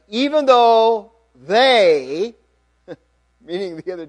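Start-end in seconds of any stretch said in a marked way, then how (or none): 0.1-2.3 THEY drawn out high and loud; stress on THEY. A narrator's reading. Reading Peter's boast to the congregation, THEY stressed loudly. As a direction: lean long and hard on "they".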